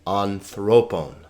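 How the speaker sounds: anthropon with the middle syllable accented; stress on THRO. Shown as a demonstration. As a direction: lean on "thro" in anthropon, falling after. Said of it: "because thro has the accent".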